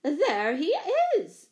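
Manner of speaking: high, loud gasp that drops away; breath-caught cry of alarm; gasped accusation. A narrator's reading. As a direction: cry it out in shock, pointing the culprit out. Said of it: "she gasped".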